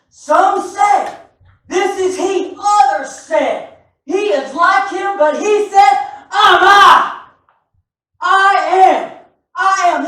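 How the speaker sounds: loud preached scripture reading, rising to a shout on I am; preaching at full force. Acted. Preacher to congregation, shouting the scripture. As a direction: proclaim it to the whole church.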